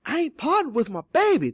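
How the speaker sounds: high, loud, emphatic refusal; emphatic, high-pitched protest. A narrator's reading. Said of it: "Teeny said emphatically".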